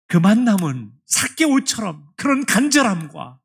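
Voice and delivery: loud, slow, emphatic appeal; fervent preaching. Acted. Preacher urging the congregation to seek Jesus earnestly, loud and slow. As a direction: stretch out each word with urgency.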